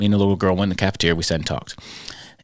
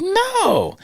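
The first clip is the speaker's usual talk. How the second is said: put-on squeaky child's no. Girl answering his silly questions.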